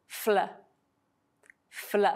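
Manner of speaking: "fl" said clearly, both consonants distinct; clearer blend. Shown as a demonstration. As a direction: give "fl" a crisp f then a clean l. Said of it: "or more clearly".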